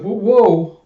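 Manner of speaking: high, loud alarmed whoa; panicked high yelps. Acted. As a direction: yelp in sudden panic. Grenade shoved in his mouth.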